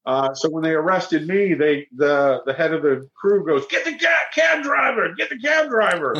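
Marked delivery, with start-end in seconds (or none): 3.7-6.1 high barked order that eases off; commanding shout. Retold or imitated. Head of the crew ordering the arrest, high shouted command. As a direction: yell the order across the street.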